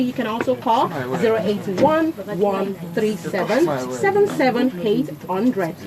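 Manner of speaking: usual speaking voice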